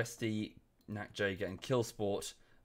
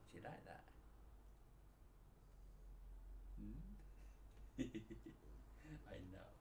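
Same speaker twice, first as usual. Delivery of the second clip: tender pet talk; 11 dB quieter than usual. Real, not acted. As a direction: murmur lovingly to a pet curled up beside you. Soft pet talk to the dog.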